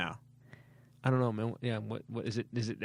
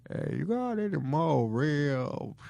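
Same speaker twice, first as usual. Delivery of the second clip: very slow, drawn-out fat uncle voice; uncle impression; slower than usual. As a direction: drag it out as the hungry uncle at a barbecue. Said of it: "I'm doing my uncle".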